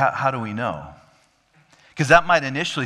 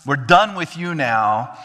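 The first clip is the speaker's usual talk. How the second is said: fed up; 5 semitones higher, 5 dB louder than usual. Retold or imitated. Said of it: "He's like".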